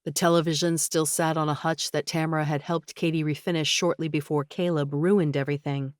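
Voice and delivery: plain reading voice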